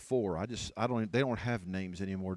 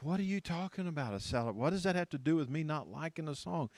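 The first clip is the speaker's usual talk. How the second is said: higher-pitched, puzzled protest; mock bafflement; 6 semitones higher than usual. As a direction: imitate someone thrown by an odd question. Acting out baffled complainers' reply.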